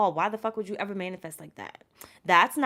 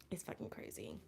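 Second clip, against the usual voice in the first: breathless whisper; 12 semitones lower, 19 dB quieter than usual. Real, not acted. Overwhelmed, voice dropping away.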